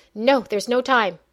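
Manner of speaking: loud, urgent refusal. Acted. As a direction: insist with urgency. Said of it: "his voice full of urgency".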